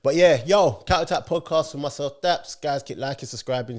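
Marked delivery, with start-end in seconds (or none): none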